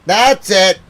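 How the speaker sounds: loud, high fed-up yell; angry shout. Real, not acted. Fed up with the BBC, shouting. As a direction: explode on the words.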